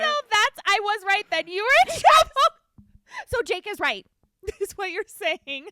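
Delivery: high, loud laughing talk. Real, not acted. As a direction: laugh through every word. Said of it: "laughing after the mom impression".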